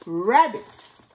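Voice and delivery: usual speaking voice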